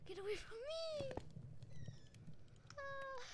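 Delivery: high, frightened cry; scared squeal. Real, not acted. Gamer squealing as a mob closes in, high and scared. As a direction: pull away in a small panic.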